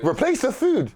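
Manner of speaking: high, loud disbelieving question; incredulous. Real, not acted. Storyteller baffled when told to replace the food, incredulous. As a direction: throw the idea back in disbelief.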